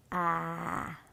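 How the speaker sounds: tongue stretched out, open-mouthed ah; tongue sticking out. Shown as a demonstration. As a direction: sound it with the tongue out. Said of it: "one more little tongue stretch".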